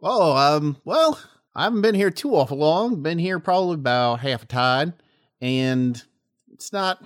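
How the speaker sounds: put-on a flounder drawling in a high southern accent; southern fish voice. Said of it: "he turned southern".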